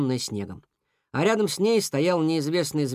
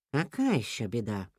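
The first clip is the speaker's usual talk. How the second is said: low sceptical question; 6 semitones lower, 6 dB quieter than usual. A narrator's reading. Sceptical reply to alarmed rabbit.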